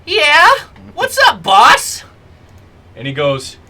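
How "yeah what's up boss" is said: high, loud squeaky minion voice; little fire snake. Acted. Little fire snake answers his boss's call, high creature voice. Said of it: "little snake creature. And Tommy goes".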